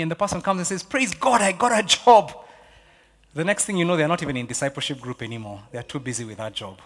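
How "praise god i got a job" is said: high-pitched mock jubilation; excited testimony. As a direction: act out thrilled good news, rising louder. Acting out an answered prayer request.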